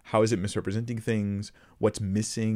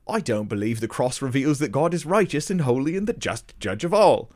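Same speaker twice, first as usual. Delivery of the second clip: louder, higher mock-British accent; terrible British accent; 5 semitones higher, 6 dB louder than usual. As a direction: put on a hammed-up British accent. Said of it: "forgive my terrible accent".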